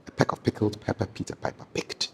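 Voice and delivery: tongue twister whispered under the breath. Shown as a demonstration. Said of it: "an example would be".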